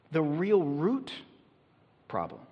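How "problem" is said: ordinary talk, nothing marked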